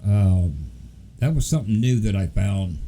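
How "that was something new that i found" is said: ordinary delivery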